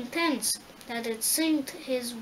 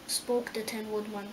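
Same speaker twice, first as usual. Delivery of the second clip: usual speaking voice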